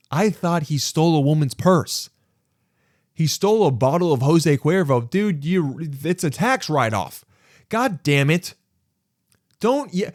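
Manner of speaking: high-pitched exasperated rant. Acted. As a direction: rant in comic outrage at a petty fuss. Exasperated rant about the petty theft.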